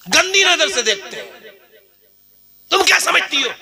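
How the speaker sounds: high, pointed rebuke; preacher scolds unveiled women; fiery preaching. Real, not acted. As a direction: drive the warning home. Preaching at full force.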